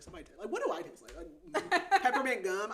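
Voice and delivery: fast, amused mock quote; laughing mockery. Reviewer mocking how romance books describe taste, laughing. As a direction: poke fun at the cliché, laughing.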